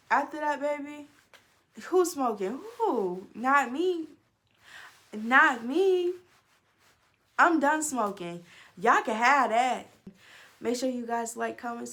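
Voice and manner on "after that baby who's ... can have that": higher, louder not me; emphatic refusal. Real, not acted. Telling viewers she quit smoking, emphatic and playful. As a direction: insist loudly, playful.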